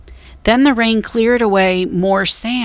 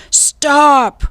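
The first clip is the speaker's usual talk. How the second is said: shout; 7 semitones higher, 4 dB louder than usual. A narrator's reading. Captain chases fleeing pirates.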